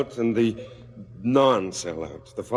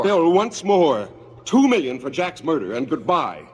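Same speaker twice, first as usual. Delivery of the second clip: firm, raised voice, slowing on the name; 7 semitones higher, 5 dB louder than usual. Acted. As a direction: lay down the terms hard and loud. Father confronts son, raised voice.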